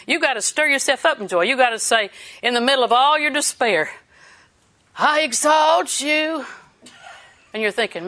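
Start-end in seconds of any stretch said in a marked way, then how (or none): 5.0-6.4 slow praise. Acted. Praising amid despair, slow.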